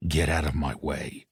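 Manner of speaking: low, menacing growl; quiet deadly threat. A narrator's reading. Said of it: "Marshall growled".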